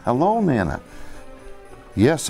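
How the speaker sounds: usual speaking voice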